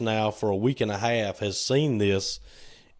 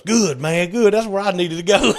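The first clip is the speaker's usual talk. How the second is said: high, loud gloating hitchhiker; laughing gloat; 10 semitones higher, 7 dB louder than usual. Retold or imitated. Hitchhiker gloating to Trey, high and laughing. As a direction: crow over the trick with a laugh.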